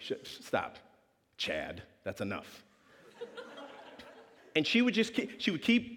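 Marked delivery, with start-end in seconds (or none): 0.0-2.6 slow hushed shushing; hushing whisper. Retold or imitated. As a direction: shush a friend under breath. Hushing friend.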